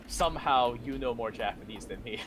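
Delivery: ordinary talk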